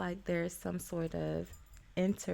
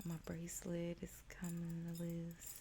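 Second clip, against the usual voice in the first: soft unvoiced whisper; 11 dB quieter than usual. Real, not acted. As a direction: whisper it to yourself as an aside. Whispered aside while shuffling.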